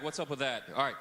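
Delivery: ordinary talk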